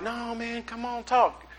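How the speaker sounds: high, soft coaxing voice. Retold or imitated. Preacher urging the man to talk, high soft coaxing. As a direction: coax softly, pitched well up.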